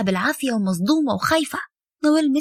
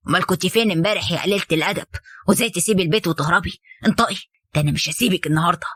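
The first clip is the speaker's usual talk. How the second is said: lower, fast, furious father's scolding; angry father; 7 semitones lower, faster than usual. A narrator's reading. Father scolding his daughter for running away, furious. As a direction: rip into the runaway daughter.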